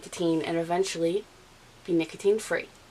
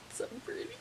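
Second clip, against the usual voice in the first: hushed whisper; barely voiced, very soft gush; 18 dB quieter, much faster than usual. Real, not acted. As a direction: whisper it in admiration. Gushing softly over her dress.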